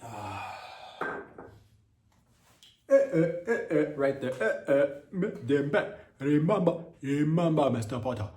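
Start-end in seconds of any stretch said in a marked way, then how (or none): 2.9-8.3 bumbling put-on Nigerian accent. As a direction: ham up a Nigerian Snape warning Mister Potter. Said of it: "my Nigerian accent".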